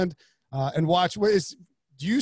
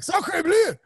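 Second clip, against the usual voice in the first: high, exaggerated French exclamation; hammed-up French accent; mock French; 12 semitones higher, 4 dB louder than usual. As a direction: squeak it like a cartoon Frenchman. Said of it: "Like, uh, like".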